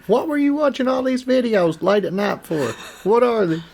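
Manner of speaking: high mocking spouse interrogation; mock suspicious wife. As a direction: play the wife catching him out.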